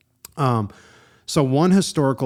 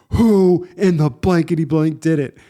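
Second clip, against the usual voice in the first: loud, high angry shout; a furious store manager yelling; 5 semitones higher, 6 dB louder than usual. Retold or imitated. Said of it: "He's yelling".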